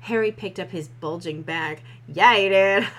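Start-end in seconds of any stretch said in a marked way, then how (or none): 2.1-2.9 loud. Acted. Loud mocking aside.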